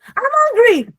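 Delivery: high, loud uncaring demand; demanding shout. Shown as a demonstration. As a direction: shout it as if only you matter. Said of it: "I don't care about anybody here".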